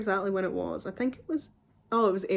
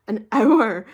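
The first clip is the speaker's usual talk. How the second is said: laughing disbelief; 10 dB louder than usual. Real, not acted. Disbelief at hour-long appointment.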